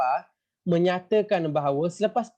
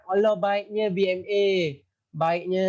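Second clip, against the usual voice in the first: mock admiration, pitched up; sarcastic; 4 semitones higher than usual. Teacher mocking the British army's kindness to children, sarcastic. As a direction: gush with fake praise for the soldiers. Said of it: "Ni sarkastik".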